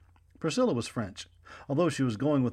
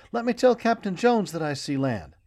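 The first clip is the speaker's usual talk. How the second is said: eager plea; 7 semitones higher, 6 dB louder than usual. Acted. Boy begs to tell the captain.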